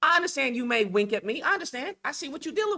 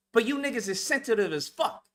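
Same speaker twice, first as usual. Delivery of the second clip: ordinary talk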